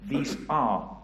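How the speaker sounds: solemn preaching. Acted. Sermon close, God watching.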